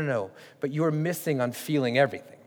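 ordinary talk, nothing marked

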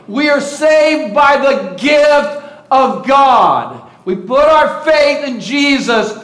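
ordinary talk, nothing marked

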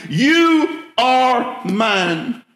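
very slow, drawn out You are mine; emphatic. Acted. Pastor assuring the congregation God claims them, emphatic and slow. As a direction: stretch every word, You are mine. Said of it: "I want you to hear that emphatically".

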